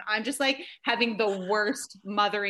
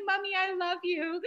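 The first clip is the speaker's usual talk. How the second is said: high little-kid voice, mock tearful; crying little boy; 10 semitones higher, 3 dB quieter than usual. Toddler consoling his bawling mom, high and mock tearful. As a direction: imitate a weepy toddler.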